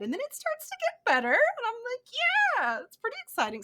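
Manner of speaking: high, bright excited rise; excited gush. Retold or imitated. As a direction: gush with mock delight. Retells own excited editing reaction.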